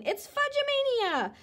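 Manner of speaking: high gleeful shout. A narrator's reading. Little girl racing away, gleeful shout. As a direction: cry it out while running off. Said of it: "She raced off shouting".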